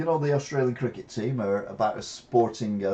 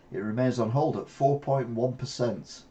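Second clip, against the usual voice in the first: normal talking voice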